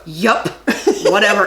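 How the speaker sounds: imitating a pissy brush-off; mildly mock-dismissive, with a gap before the last word. Husband mimicking his wife's pissy tone, as she retells it. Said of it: "You get all pissy at me".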